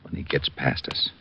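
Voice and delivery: hushed whisper in the dark; tense whisper so the crook won't hear. Acted. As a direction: keep it under your breath, waiting to pounce. Ambush, waiting in the dark.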